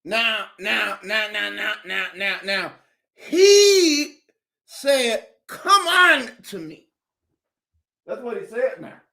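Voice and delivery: mock pulpit cadence, stretched; preaching. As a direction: preach scripture to the flock. Mock sermon continues.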